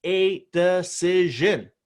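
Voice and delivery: slow, emphatic "a decision" to drive the point home; drawn out for emphasis. Shown as a demonstration. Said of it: "I extended that a little bit, but that was for emphasis".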